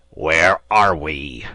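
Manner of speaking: slow, loud, seething whisper-shout; captain choking on his rage; furious slow rasp; angry demand. A narrator's reading. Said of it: "his anger permitted him to utter".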